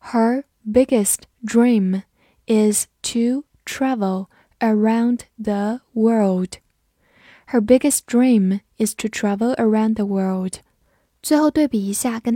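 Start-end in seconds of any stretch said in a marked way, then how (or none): 0.1-6.6 slow, carefully spaced words; slow model reading. Shown as a demonstration. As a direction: read slowly, word by word, for learners to repeat. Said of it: "我们慢读一遍". (慢速逐词朗读)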